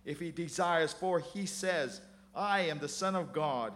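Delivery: mocking. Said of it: "mocked him".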